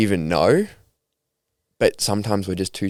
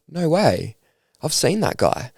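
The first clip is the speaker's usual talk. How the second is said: mock surprise; 8 semitones higher, slower than usual. Friend surprised, retold by girl.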